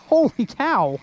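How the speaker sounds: ordinary delivery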